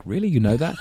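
high, disbelieving question; incredulous. Retold or imitated. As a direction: ask it in mock disbelief. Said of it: "I'm like".

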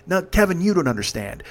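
ordinary talk, nothing marked

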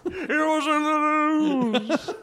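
high, slow, laughing croak; Neil Hamburger. Retold or imitated. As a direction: drag the punchline out high and choked. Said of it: "doing Neil Hamburger".

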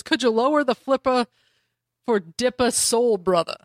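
ordinary talk

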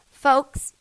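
loud, high stump-speech call; politician voice. Pretend. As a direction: address the rally crowd. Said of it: "Every candidate has a different accent".

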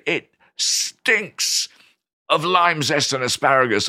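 STINKS drawn out in high relish; gleeful, high-pitched tasting verdict; punchy relish. Acted. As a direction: hit and stretch stinks. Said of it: "instead of us being able to say".

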